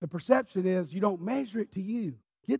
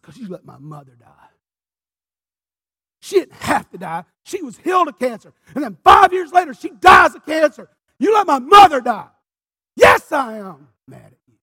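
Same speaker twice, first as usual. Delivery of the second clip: anguished anger; 6 semitones higher, 17 dB louder than usual. Retold or imitated. Grieving son accuses God over his mother, building shout. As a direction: hurl the blame at God, building to a yell.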